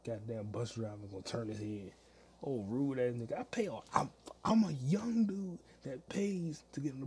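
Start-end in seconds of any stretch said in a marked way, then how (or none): none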